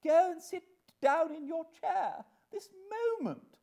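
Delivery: high, loud imperious command; crushing dignity. Acted. Mother sending Jane to her chair, imperiously angry. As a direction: order the child down with outraged dignity. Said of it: "Missus Pocket's dignity was so crushing".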